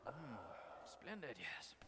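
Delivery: breathy whisper of delight. Acted. [name] savouring the food, whispered. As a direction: whisper the praise under the breath.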